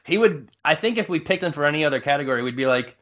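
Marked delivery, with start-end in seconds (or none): none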